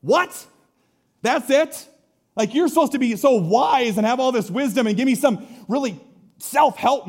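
high, loud indignant outburst; angry disbelief. Retold or imitated. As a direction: scoff in outrage at the advice. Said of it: "very angry 'cause he's like".

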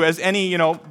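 normal talking voice

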